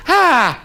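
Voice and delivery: high yelped "ha"; mock cry of pain. Chief cries out as the hammer hits, high mock yell. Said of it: "the chief shouted".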